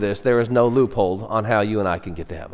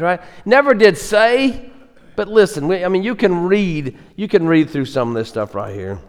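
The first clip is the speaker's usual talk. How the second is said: ordinary talk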